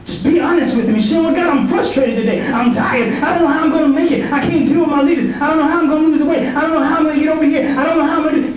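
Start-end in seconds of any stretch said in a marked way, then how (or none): 1.2-8.5 high-pitched, pleading complaint to God; acted exasperated venting; frustrated prayer. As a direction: pour out your troubles in a raised pitch. Voicing a weary person's candid prayer.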